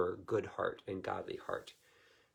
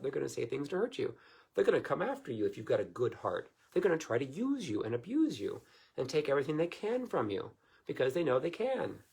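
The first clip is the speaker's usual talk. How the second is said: ordinary delivery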